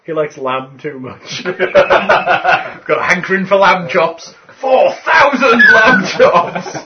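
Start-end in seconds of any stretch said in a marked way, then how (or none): 4.6-6.8 excited laughing shout. Real, not acted. Joking exclamation about troll.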